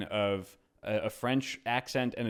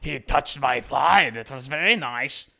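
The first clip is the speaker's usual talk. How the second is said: loud, drawn-out mock German-Russian accent; bad German accent; 3 semitones higher, 5 dB louder, slower than usual. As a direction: put on a terrible, aggressive German accent for an audiobook read. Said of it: "If it's like Russian or a German chick".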